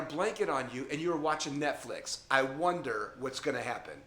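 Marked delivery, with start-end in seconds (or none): none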